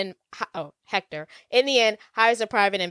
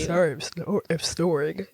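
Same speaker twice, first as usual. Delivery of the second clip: words through a yawn. Real, not acted. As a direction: speak mid-yawn. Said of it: "No, I'm yawning".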